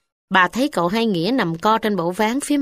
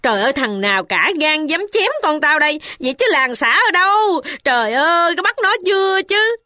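much higher, shrill outraged demand; outraged cry; 8 semitones higher than usual. A narrator's reading. Mother demanding who cut her son, outraged high cry. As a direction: demand answers in furious alarm. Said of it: "Bà cai hỏi rằng".

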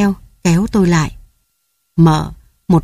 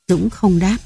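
usual speaking voice

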